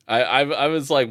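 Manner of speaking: ordinary talk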